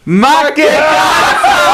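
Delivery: long, drawn-out high holler; calling out catchphrase. Retold or imitated. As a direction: re-enact a joyful call across a plane. Calls out catchphrase to students.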